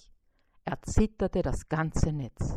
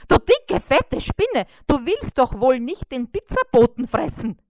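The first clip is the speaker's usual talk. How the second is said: much higher, louder alarmed fly; shocked cry; 6 semitones higher, 8 dB louder than usual. A narrator's reading. Fly horrified at the spider wrapping the beetle, loud high cry. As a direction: cry it out aghast. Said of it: "rief er erschrocken".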